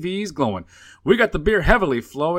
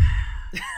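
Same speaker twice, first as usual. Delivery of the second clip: breathless high giggle; airy laugh; 7 dB quieter than usual. Real, not acted. Cohost cracking up at the voodoo story.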